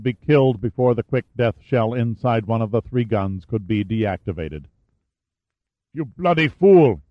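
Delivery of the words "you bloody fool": loud, high angry outburst; angry grunt. Acted. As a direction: grunt it out in fury. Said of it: "he grunted".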